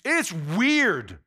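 ordinary delivery

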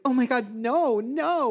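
high-pitched, hammed-up refusal; high, appalled imitation of a server; mimicked horrified waitress. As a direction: refuse in mock horror, voice way up. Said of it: "And the waitress is like".

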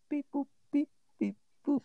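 robot voice. Pretend. Dialing phone noises vocally.

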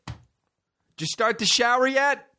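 very high, drawn-out check-up call; imitated nagging mom. Mom checking on the shower, high slow nagging call.

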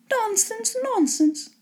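high, soft exclamation of vexation; dismissive mother. A narrator's reading. Vexed wife dismissing husband's offer.

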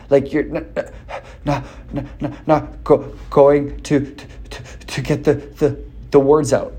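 halting demo stutter on repeated sounds; mock stuttering. Shown as a demonstration. Coach showing how an anxious speaker stutters. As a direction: act out a blocked, stuttering start. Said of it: "you're anxious".

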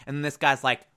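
ordinary talk

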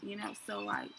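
ordinary talk, nothing marked

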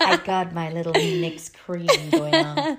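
giggly mock sultry; laughing sexy voice. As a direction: laugh through a put-on sultry tone. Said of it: "Is that sexy voice?".

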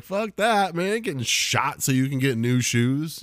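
hammed-up indignant boyfriend; mock outraged refusal. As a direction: refuse loudly, dragging out the complaint. Acted refusal to girlfriend's shopping plan.

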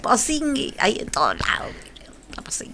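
playful high then whispered; whispering. Real, not acted. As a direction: breathe the silly word softly. Playful echo of silly word.

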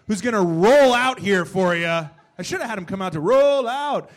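hype announcer. Acted. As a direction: roll the co-host out like a headliner. Host brings out co-host.

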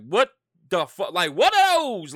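loud, high mocking callout; mock shout. Yelling at somebody about what they wear, mock shout. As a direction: shout it with a long-drawn last word. Acting out calling out someone's outfit.

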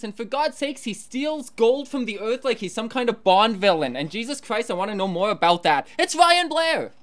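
rising to a high, loud reveal; building hype introduction. Acted. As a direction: build it up and announce the guest.

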